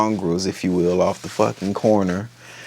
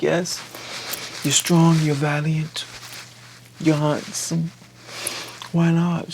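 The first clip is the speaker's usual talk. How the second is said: higher, lightly voiced admirer; coy flattery; 6 semitones higher, 4 dB quieter than usual. Woman wooing Tylen as father of her children, coyly. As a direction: list the compliments softly, a pause between.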